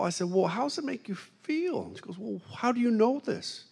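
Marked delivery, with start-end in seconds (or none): 0.3-1.8 gentle high question. Retold or imitated. 2.2-3.7 higher, wary question; anxious waitress. Retold or imitated. The waitress asking the preacher, higher and wary.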